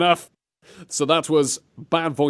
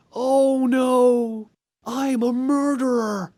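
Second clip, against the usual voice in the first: mock bad acting; 7 semitones higher, much slower than usual. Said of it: "mocking the game line".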